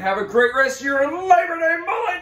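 hype shout. Real, not acted. Hyped sign-off shout.

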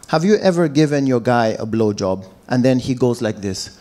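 ordinary talk, nothing marked

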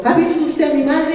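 put-on high-pitched child asking a stranger; little boy. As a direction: put on a small lost boy's high voice. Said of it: "el niño al pueblo y dijo".

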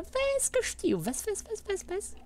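very high falsetto chatter; squeaky self-talk. Real, not acted. Player muttering to himself in the game, high squeaky voice. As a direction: squeak it out in quick little repeats.

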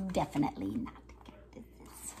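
a low, secret whisper; whispered aside. Acted. Aside after hugging a cactus.

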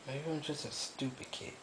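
very soft, defeated self-blame; real quiet despair; hopeless murmur. Real, not acted. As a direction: let the words sink away, nothing left. Hopeless self-loathing, very low voice.

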